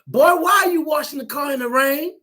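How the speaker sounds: mom's exasperated yell; mother scolding. Retold or imitated. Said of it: "she says".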